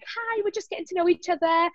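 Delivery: high, softly voiced introduction; put-on high getting-acquainted voice; mock first-day greeting.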